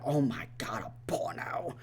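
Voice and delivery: hushed, whispery mock shock; retold disbelief, trailing into a whisper; mock freaking out. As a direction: drop into a scandalized whisper. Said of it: "they're just freaking out".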